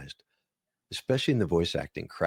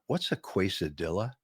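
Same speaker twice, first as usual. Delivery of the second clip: naive Minnesotan mangling Spanish; northern Minnesota accent. Retold or imitated. Newcomer at a Mexican restaurant, Minnesota accent. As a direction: ask as a puzzled Minnesota newcomer. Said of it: "when you first moved from Minnesota".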